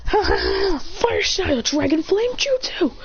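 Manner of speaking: high, slow mock-villain laugh into a jutsu name; laugh, then bellow the move out slowly; high slow anime attack cry; gloating attack call. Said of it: "Then he goes".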